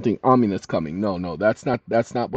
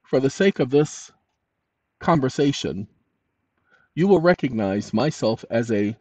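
dictating. Shown as a demonstration. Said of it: "Now, watch this".

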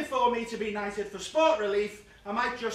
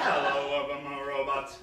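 lower, steady droning robot; 7 semitones lower than usual. Pretend. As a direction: greet them like a machine. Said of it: "I did a new show with that".

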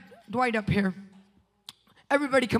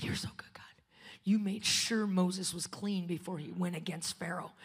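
soft, tearful-sounding whisper to God; whispered prayer; 6 semitones lower, 8 dB quieter than usual. Retold or imitated. Preacher retelling his prayer to God, whispered. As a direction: pray it under your breath. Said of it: "I said".

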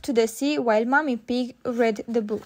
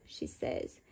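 ordinary delivery, nothing marked

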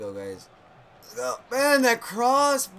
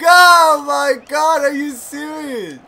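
laughing shout; 4 semitones higher, 10 dB louder, slower than usual. Real, not acted. Disbelief at game play.

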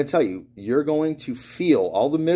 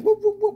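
very high repeated whoops; imitated whirling noise; 3 dB louder than usual. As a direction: mimic a bottle tumbling through the air. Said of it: "I could hear that thing".

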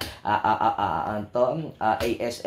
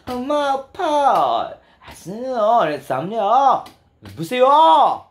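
high, sing-song fake Korean; mock Korean accent; 7 semitones higher, 4 dB louder, much slower than usual. Showing the tone Koreans talk in, mock Korean accent. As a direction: stretch the endings in exaggerated Korean intonation. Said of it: "Ingani lang tono mo istorya".

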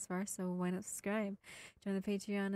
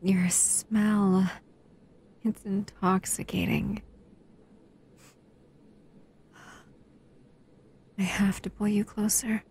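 breathy intimate whisper; 11 dB louder than usual. Acted. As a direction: whisper it close, as if drunk on their scent. Intoxicated by the listener's scent.

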